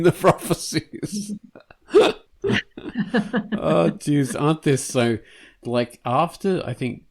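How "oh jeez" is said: usual speaking voice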